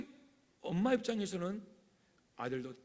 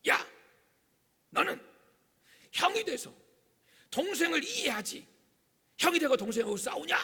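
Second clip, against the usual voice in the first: high, loud mock telling-off; scolding mother; 10 semitones higher, 6 dB louder than usual. Mother scolding the older brother for fighting, high and loud. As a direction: play an exasperated mother scolding her son. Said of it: "엄마가 딱 중재를 합니다".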